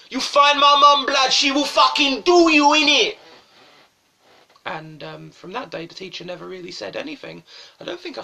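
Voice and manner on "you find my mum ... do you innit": very loud, high schoolboy comeback; hammed-up bellowed threat; defiant shout. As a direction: yell back at the teacher in mock outrage. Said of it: "he turned around to the teacher and he went".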